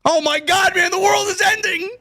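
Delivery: very high, crying wail; frantic sidekick robot wailing in alarm; panicking robot. Retold or imitated. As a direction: wail that the world is ending, hands in the air. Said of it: "and it's like".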